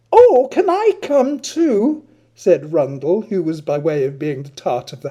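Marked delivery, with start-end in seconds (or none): 0.1-2.0 much higher, louder and slow; eager squeal. Acted. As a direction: gush it out coquettishly. Rundle the house tart asks.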